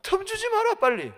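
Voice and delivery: high, loud command; urgent exhortation. Real, not acted. Preacher urges people to guard against devil, urgent shout.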